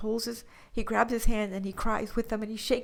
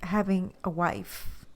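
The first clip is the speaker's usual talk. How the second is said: ordinary talk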